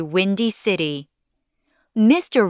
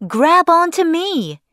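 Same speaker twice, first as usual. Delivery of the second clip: high, raised-voice command; urgent call; 8 semitones higher, 4 dB louder than usual. A narrator's reading. Father to son blown away, urgent shout. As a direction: call out urgently to save someone.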